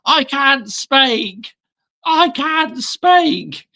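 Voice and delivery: imitating a speechless darts commentator. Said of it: "the commentator was absolutely speechless".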